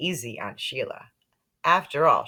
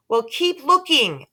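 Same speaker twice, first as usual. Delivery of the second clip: yelling; 9 semitones higher, 5 dB louder than usual. A narrator's reading. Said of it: "I yelled".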